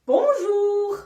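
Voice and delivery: "Bonjour" said high and loud in French, bon-ZHOOR; French word for kids; French word spoken. Shown as a demonstration. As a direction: announce "Bonjour" brightly in French. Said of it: "Comment dit-on?".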